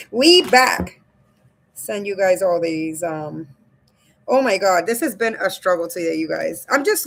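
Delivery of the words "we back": loud, happy chant of a comeback; a pumped shout of we back; hyped cheer. Real, not acted. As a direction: call it out to the chat like a victory. Streamer cheering return to stream.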